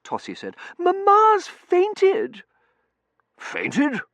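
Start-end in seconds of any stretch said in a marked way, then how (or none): 0.8-2.4 very high, slow, loud wail; high-pitched daughterly alarm; distressed daughter. A narrator's reading. Daughter wailing the news. 3.4-4.0 raised, loud, incredulous query; blustering disbelief; startled colonel. A narrator's reading. Colonel reacting to the news.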